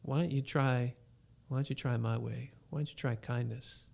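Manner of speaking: God answering the father's prayer, softly; God's gentle voice. Retold or imitated. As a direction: speak quietly like a kind guide. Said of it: "he goes".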